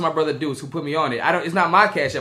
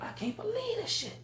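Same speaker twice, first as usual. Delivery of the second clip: very high, much softer aside; muttered disbelief; 12 dB quieter than usual. Retold or imitated. Friend sides with her, very high soft disbelief. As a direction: mutter it in shock. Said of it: "I was like".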